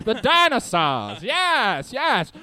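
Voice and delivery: put-on high old-timey Mid-Atlantic showman; Mid-Atlantic sideshow hype; Mid-Atlantic accent. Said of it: "best Mid-Atlantic impression".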